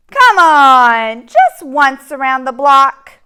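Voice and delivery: loud, whiny pigeon begging; the pigeon begs and pesters the reader; pleading pigeon. Acted. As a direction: wheedle like a pushy little bird.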